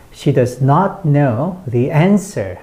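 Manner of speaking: slow, strong "not know" and "answer"; sentence stress demo. Shown as a demonstration. Teacher reads along with learners, slow stress on "not know", "answer". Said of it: "요런 식으로".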